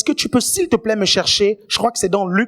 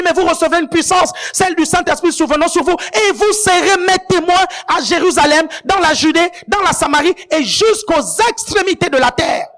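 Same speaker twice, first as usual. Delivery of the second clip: preaching scripture; 5 semitones higher than usual. Acted. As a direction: proclaim it to the church. Quoting scripture with force.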